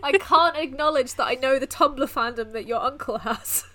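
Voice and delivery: mock frantic inner voice, half-laughing; playing it cool while freaking out inside. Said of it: "I can't freak out about this".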